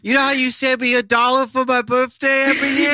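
put-on high squeaky little kid asking for money; high childish plea to grandma. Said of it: "I don't know what, what voice that is".

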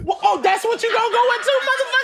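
high-pitched, indignant disbelief; worked-up, scandalized complaint; incredulous outburst. Real, not acted. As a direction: protest the topic change, voice way up. Incredulous at callous change of topic.

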